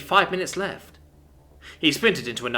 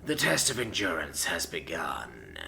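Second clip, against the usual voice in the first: low, slow rasping whisper; hoarse whisper; 9 semitones lower, 8 dB quieter, much slower than usual. A narrator's reading. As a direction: breathe it out rough and low. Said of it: "said with a hoarse".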